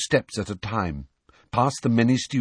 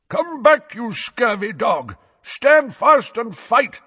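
much higher, louder, slow bellowing challenge; muffled knightly yell; 6 dB louder, slower than usual. A narrator's reading. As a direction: roar a challenge from behind a visor. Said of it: "yelled Sir Cadogan in a muffled voice".

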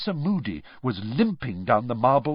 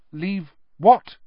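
loud, high puzzled question; bewildered; 8 semitones higher, 6 dB louder than usual. A narrator's reading. Harry has no idea who is being shouted at, bewildered. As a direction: ask it in startled confusion.

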